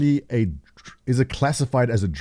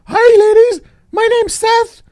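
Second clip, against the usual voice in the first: squeaky sky-high helium voice, much louder; 9 dB louder, slower than usual. Pretend. Guy on helium hitting on ladies at a party, squeaky high. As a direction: chipmunk pickup line after a hit of helium. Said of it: "you take a big hit of helium".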